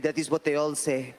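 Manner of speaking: knowing Indian accent, lightly put on. Border guard teasing the visitor in Indian accent. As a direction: drop into a mild Indian accent, wry. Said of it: "Is that accent offensive?".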